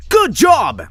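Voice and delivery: high, bright yelled cheer; cheerful shout. Acted. Cheering Jeff after throwing the baby, high cheery shout. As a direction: call it out happily. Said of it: "You laugh and shout".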